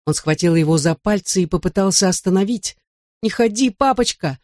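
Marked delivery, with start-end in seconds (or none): 3.2-4.4 put-on crying-out child. A narrator's reading. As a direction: beg daddy in a raised child's pitch. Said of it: "закричал он".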